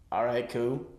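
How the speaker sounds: usual speaking voice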